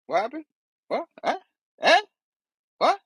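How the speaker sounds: ordinary talk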